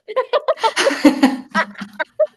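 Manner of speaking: loud high laugh-cry. Real, not acted. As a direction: burst into laughing sobs.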